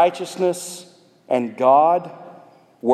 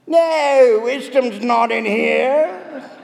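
talking cave; 9 semitones higher, much slower than usual. Acted. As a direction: answer as a cave in a silly accent. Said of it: "And the cave speaks back".

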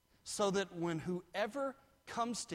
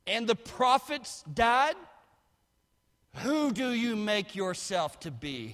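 full-force preaching; 8 semitones higher, 10 dB louder than usual. Acted. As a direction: throw the mocking question at the crowd.

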